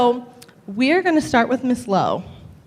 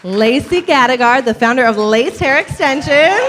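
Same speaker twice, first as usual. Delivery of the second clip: raised MC voice over applause; hyped announcement; 7 semitones higher than usual. Real, not acted. Host announcing panelists to a crowd, excited call. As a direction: call out each guest's name, pitch climbing.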